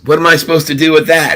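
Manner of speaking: acted incredulous banker, high and quick; baffled objection. Banker shown a pink elephant.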